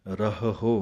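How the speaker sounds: ਰਹਹੁ as rah, then hu straight after; correct pronunciation. Shown as a demonstration. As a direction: join both h's in ਰਹਹੁ, short ending. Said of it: "ਉਚਾਰਨ ਕਰਨ ਦਾ ਢੰਗ ਇਸ ਤਰ੍ਹਾਂ". (ਰਹਹੁ ਵਿੱਚ ਰਹ ਪਹਿਲਾਂ, ਹੁ ਨਾਲ ਹੀ)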